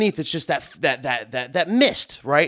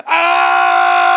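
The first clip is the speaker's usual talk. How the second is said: long, loud, high-pitched scream; drawn-out "aah" of retold terror; mock panicked scream; 14 semitones higher, 7 dB louder than usual.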